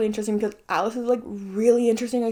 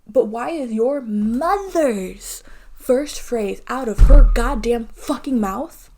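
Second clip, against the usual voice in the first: angry outburst; 7 dB louder, much slower than usual. Real, not acted. Ranting about Renee.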